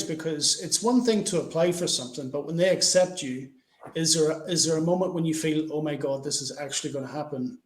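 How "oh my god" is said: ordinary delivery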